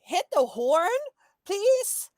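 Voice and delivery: loud, high shout to the driver; acted yell from a car window; urgent called-out plea. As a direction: call it out, climbing high on the plea. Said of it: "she's gonna say".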